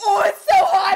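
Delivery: laughing yelp, it burns; shriek. Real, not acted. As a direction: squeal out loud at the heat. Testing hot wax on hand.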